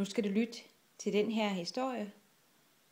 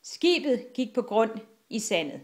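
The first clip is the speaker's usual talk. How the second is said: ordinary delivery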